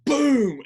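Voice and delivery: loud, high-pitched "boom"; big sound-effect blast; imitated bang. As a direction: burst out with a sudden explosive boom. Imitating sudden bang in the scene.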